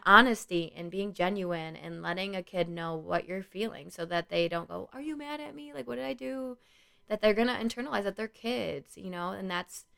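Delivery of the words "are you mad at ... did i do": high, soft imitation of an anxious child; worried kid. Kid fearing adult is mad, mock worried. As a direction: plead softly in a pitched-up kid voice.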